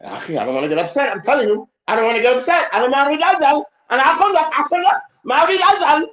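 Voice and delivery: from calm to loud mock protest; mock angry man building up. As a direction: play a man losing his temper step by step. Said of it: "for some of us, they do this".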